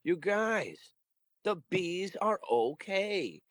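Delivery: high, drawn-out gushing news anchor; slow, high mock good-news delivery; happy news story. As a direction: announce it like heartwarming local news. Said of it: "local news wants to do some fucking happy story".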